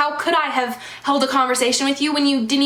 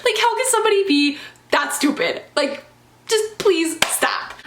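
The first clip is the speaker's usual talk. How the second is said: higher, laughing through real irritation; heated and mad; 7 semitones higher than usual. Real, not acted. Recalling a bad date to viewers, heated and exasperated. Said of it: "and I'm so mad".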